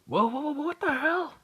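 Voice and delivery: very high panicked cry; startled yelp. Acted. Boy dodging a spear, shrieking in high alarm. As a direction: yelp in shock as a spear flies at you. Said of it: "he just goes".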